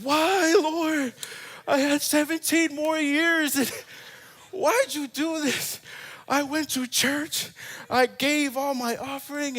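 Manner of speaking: loud, high-pitched wailing complaint to God; hammed-up anguished shout, drawn out; crying out. Driver blaming God after hitting the hydrant, dramatic crying out. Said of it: "I did that big scene and I cried out".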